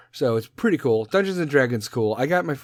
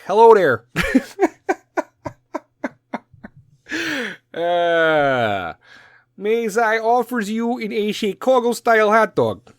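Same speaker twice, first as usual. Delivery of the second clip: high, hammy Chicago voice; fake Chicago accent; 9 semitones higher, 6 dB louder, slower than usual. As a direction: ham up a Chicago tourist. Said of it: "with my Chicago accent, fake as fuck".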